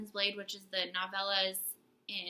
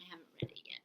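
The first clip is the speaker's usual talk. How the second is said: whispering; 4 semitones lower, 14 dB quieter, much faster than usual. Real, not acted. Whispered reply.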